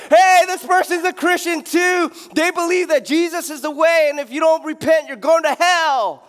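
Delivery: high, loud mock street preacher yelling; hammed-up announcing to the whole store. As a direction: follower shouting repent at Costco. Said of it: "they follow you to Costco".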